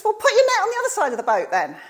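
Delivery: high, fast and loud; the man on the shore calls to the boat; calling out. Retold or imitated. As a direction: call the advice across the water. Stranger calls advice from shore.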